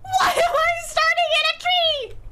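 high-pitched, wailing questions; comic high cry of confusion; bewildered wail. Real, not acted. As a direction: wail it in disbelief. Bewildered at spawn spot.

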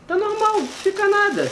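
high squeaky voice; squeaky balloon voice; helium voice. Real, not acted. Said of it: "Fica não, cara".

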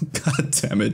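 laughing under breath; breathy laugh through words. Real, not acted. Laughing at own mistake.

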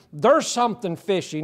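normal talking voice

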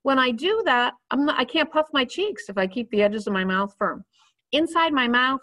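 ordinary talk

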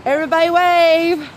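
high, loud call to everyone; rally the crowd to wave; bright shout-out to the people nearby. Real, not acted. Calling to people around her.

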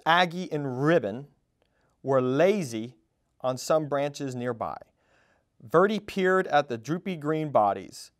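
ordinary delivery

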